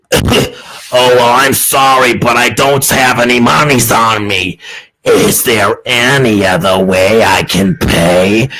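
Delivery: slow, low seductive woman; hammed-up porn seductress, drawn out and low; sensual customer. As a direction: play a mock temptress, slowly. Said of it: "As she touches you all sensually".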